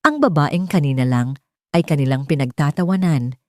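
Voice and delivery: ordinary delivery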